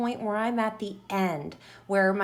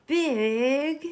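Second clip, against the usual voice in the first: big said loud and higher, punched; stress on big; 5 semitones higher, 4 dB louder than usual. Shown as a demonstration. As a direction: punch the word big, all capitals. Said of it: "so I'm gonna put big in all capital letters".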